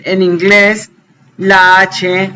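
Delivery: usual speaking voice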